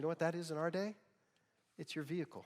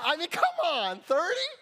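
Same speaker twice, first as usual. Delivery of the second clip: high, loud incredulous question; loud disbelief; 13 dB louder than usual. Real, not acted. Pastor scoffing at the mom's threat, loud disbelief. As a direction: protest with laughing disbelief.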